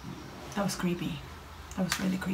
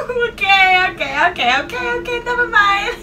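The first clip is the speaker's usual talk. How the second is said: high, loud panicked babble; startled panic; 9 semitones higher, 7 dB louder than usual. Real, not acted. Player backing down after a jump scare, high and panicked. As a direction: repeat it in a high-pitched fluster.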